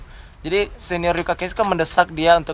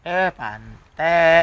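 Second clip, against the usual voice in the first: friendly jab; much slower than usual. Shown as a demonstration. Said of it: "Pasti kayak, "Heh," gitu".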